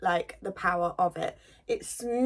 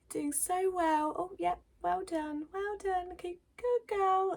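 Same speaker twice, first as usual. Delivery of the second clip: higher, slow, soothing praise; imitating the hygienist; 10 semitones higher, 4 dB quieter, slower than usual. Hygienist praising the patient like a little girl, high and coaxing. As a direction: coo at a nervous patient, much higher. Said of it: "she's like".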